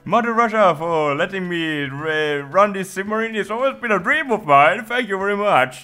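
high, loud chipper sailor; cheery sailor voice. Pretend. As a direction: play a gushing happy sailor.

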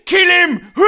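very high, loud screech; crazed mock shout. Crazed Pokemon egging on violence, mock shout. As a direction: shriek it like a mad little creature.

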